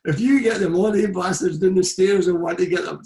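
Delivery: laughing while voicing the furious boss; hammed-up manager rant; imitating angry gaffer. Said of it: "He says".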